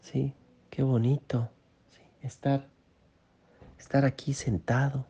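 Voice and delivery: quiet, awed and content; soft wonder. Shown as a demonstration. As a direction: savour the moment of just sitting here. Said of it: "Si hagas así como".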